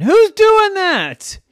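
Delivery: high, loud drawn-out protest; indignant kid. Acted. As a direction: wail it slowly as a tumbled child. Tumbled Miles protests.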